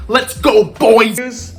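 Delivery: excited shout. Real, not acted. Hyping start of road trip.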